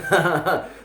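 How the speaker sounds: amused laugh at own joke. Real, not acted. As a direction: burst out laughing. Laughs at his own bit.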